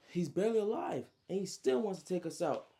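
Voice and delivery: high, loud disbelief; indignant outburst. Retold or imitated. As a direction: rant in shocked outrage. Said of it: "Trey's like".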